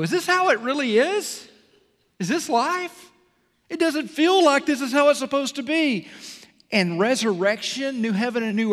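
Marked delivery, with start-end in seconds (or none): 0.0-6.1 higher, pleading questions; anguished questioning. Retold or imitated. Worshipper in pain asking if this is life, pleading. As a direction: ask it like someone lost in their pain. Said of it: "and you go".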